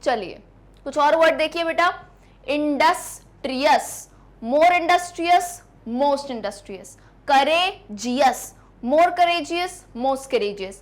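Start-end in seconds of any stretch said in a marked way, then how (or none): 2.5-4.1 industrious slowly split as Indus, ट्रियस; syllable by syllable. Shown as a demonstration. Teacher breaking words up for students, syllable by syllable. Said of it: "words को तोड़ना आ रहा है". 7.3-8.4 courageous slowly split before its last part; syllable by syllable. Shown as a demonstration. Teacher breaking words up for students, syllable by syllable. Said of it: "words को तोड़ना आ रहा है".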